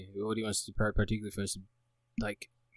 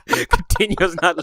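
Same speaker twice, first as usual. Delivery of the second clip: laughing; 8 semitones higher, 10 dB louder, slower than usual. Real, not acted. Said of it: "Look at you sweating".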